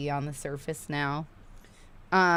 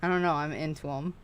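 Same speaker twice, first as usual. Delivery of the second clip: ordinary talk, nothing marked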